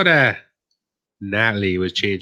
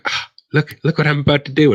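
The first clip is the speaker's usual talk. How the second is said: cocky showing off; 6 semitones higher, 3 dB louder than usual. Retold or imitated. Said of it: "I was like".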